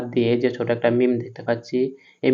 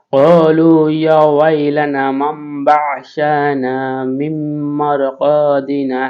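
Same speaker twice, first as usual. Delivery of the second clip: melodic Quran recitation; 2 semitones higher, 6 dB louder, much slower than usual. Acted. Teacher recites the completed verse with full melody, slowly.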